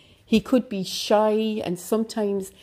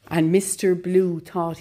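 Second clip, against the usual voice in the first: ordinary delivery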